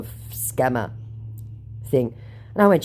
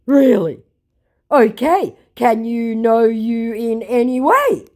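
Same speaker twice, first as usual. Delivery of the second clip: higher, louder, slow put-on accent; mock scammer voice; 7 semitones higher, 6 dB louder, slower than usual. Quoting the scammer's clumsy plea, slow and put-on. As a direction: ask it clumsily.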